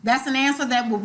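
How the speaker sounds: ordinary delivery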